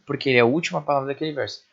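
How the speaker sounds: usual speaking voice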